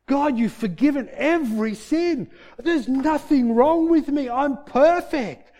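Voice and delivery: high, loud cries of amazed joy; loud, high-pitched shouts of revelation; ecstatic yell. Retold or imitated. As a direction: yell it to God, overwhelmed and elated, stretching the words. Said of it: "I'm starting to yell this".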